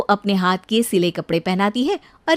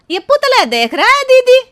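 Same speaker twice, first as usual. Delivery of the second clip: put-on excited village girl; 13 semitones higher, 8 dB louder than usual. Kamla gazing at mannequin, excited.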